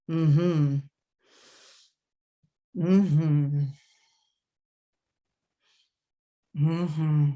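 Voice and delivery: drawn-out, low-key "mm-hmm"; low huddle hum. Shown as a demonstration. Coach demonstrating how athletes huddle, low humming. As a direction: hum it slowly, with space between.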